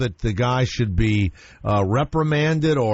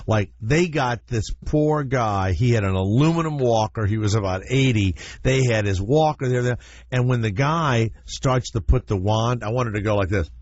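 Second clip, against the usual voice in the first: normal talking voice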